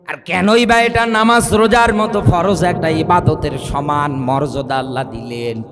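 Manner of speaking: usual speaking voice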